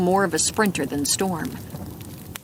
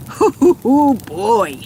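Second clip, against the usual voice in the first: loud high hooting; gleeful hoot; 4 semitones higher, 6 dB louder, slower than usual. A narrator's reading. Gunner hooting at the caught thief, loud gleeful hoots. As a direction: relish the trouble he's in.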